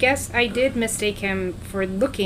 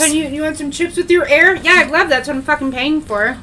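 loud, high sarcastic mock customer; sarcastic rant; 6 semitones higher, 5 dB louder than usual. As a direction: rant sarcastically about paying for air.

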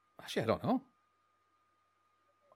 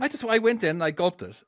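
usual speaking voice

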